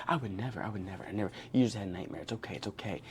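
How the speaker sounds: very soft, low imitation of maternal reassurance; soothing mother. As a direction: hush the child after a nightmare. Voicing mother soothing her son.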